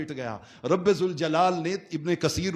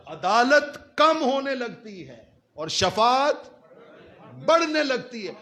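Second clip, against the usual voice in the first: high, stretched words with a long suspenseful pause; 5 semitones higher than usual. Real, not acted. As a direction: draw it out and climb before the last phrase. Preacher repeats it for dramatic effect.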